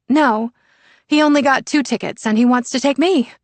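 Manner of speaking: put-on a teen gushing about her brother's plans; excited teen daughter. Sienna answers on the phone.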